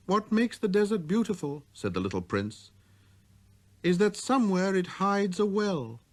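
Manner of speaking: put-on high, light boyish voice; a small boy's clear greeting; little prince voice.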